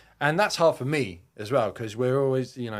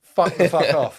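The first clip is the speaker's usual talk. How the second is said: mock refusal; 6 semitones higher, 9 dB louder than usual. Mocking the helicopter crew's refusal.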